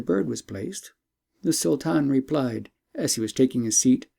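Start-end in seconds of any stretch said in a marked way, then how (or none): none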